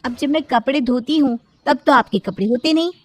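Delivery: lightly voiced, put-upon sister-in-law; defiant sister-in-law. Bhavna refuses to wash her brother-in-law's clothes, defiant.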